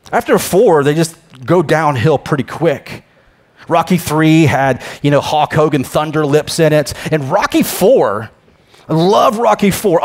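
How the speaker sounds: normal talking voice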